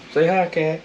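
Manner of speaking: high sing-song voice for the cat; high pet-talk voice. Real, not acted. Talking to the cat, high pet-talk voice.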